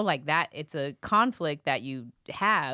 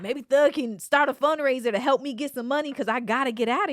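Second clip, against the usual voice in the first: higher, louder pleading voice; inmate's voice; 8 semitones higher, 4 dB louder than usual. Retold or imitated. Host imitating an inmate wanting a fundraiser. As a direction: imitate the inmate asking for money. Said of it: "And he's like".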